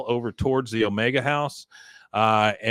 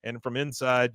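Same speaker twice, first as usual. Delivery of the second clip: ordinary delivery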